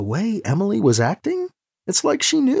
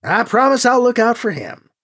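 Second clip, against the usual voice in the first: higher-pitched, projected promise; Gentry's promise; 6 semitones higher, 4 dB louder than usual. A narrator's reading. Gentry echoes Alibi's promise to Anita, pitched up. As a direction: echo the promise with lifted pitch. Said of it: "Gentry said".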